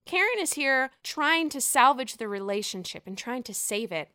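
ordinary delivery

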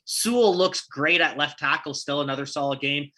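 ordinary talk, nothing marked

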